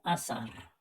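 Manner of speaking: azar said softly with an s. Shown as a demonstration. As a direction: say azar as "asar", z like s. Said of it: "y en la segunda lo mismo". (azar con seseo, en voz baja)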